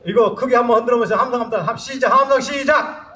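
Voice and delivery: rallying shout. Real, not acted. Singer to the audience before the last song, rallying shout. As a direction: shout to get the whole audience cheering.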